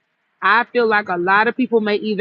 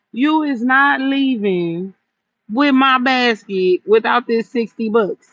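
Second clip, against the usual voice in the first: firm refusal; slower than usual. Retold or imitated. Firmly refusing a haggling customer.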